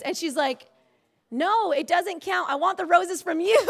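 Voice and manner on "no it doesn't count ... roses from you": high, insistent imitation of a hurt wife; indignant protest. Wife objecting that her mother's roses don't count, indignant.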